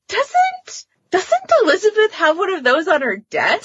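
giggly high-pitched retold character question; high mock incredulous. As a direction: squeak it out like a scandalised character. Characters retold eyeing the pegging device.

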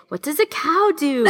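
put-on high, sing-song question to a toddler; a light imitation of a mum asking her toddler; coaxing mother voice. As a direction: coax a small child into answering.

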